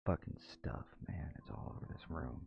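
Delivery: hushed muttering to himself; whispering. Real, not acted. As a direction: mutter it under your breath. Muttering about clutter.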